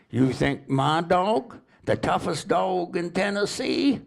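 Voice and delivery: high, slow proud Tennessee drawl; Tennessee drawl, bragging. Retold or imitated. Dog owner brags to the stranger in a slow Tennessee drawl. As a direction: boast in a drawn-out Southern twang. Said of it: "they talk kinda slow and drawl down there".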